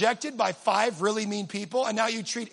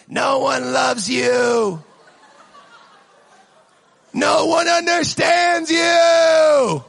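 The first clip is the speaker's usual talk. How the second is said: slow, drawn-out louder taunting yell; 4 semitones higher, 9 dB louder, much slower than usual. Retold or imitated. Demon across the street taunting you, slow louder yell. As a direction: drag it out like a creepy doll jeering across the street. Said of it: "it's just yelling over you".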